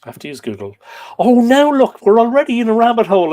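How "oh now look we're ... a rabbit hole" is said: much higher and louder, a fast comic groan of frustration; exasperated outburst. Real, not acted. Teacher to the online class about the search detour, loud exasperation. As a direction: throw up your hands loudly at the detour.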